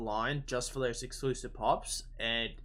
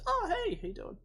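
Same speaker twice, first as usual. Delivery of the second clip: squeaky, much higher mock greeting; friend's greeting. High school friend greeting him at the con, squeaky high. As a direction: chirp it like a surprised friend. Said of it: "He goes".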